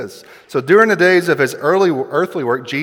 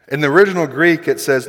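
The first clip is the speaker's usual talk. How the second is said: ordinary delivery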